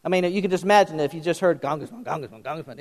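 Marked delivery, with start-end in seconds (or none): 1.6-2.8 low, very soft repeated mutter; muttered onomatopoeia. Shown as a demonstration. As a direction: mumble the word over and over under your breath. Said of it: "it would kinda sound like".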